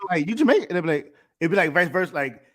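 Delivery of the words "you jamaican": high, rising surprised "You Jamaican?"; surprised question. Retold or imitated. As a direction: ask it in mock disbelief, pitched up.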